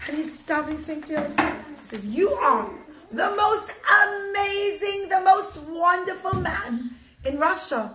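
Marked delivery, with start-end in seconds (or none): 2.1-7.8 loud, high, stretched proclamation; grand praise. Acted. Judge surprises the accused man with lavish praise, exultant. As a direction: build to a booming compliment.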